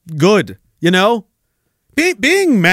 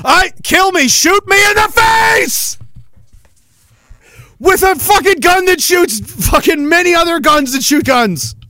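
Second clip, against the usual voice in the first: much higher, hammed-up yell, stretched out; mock despairing shout; 10 semitones higher than usual. Mock despair at radio song.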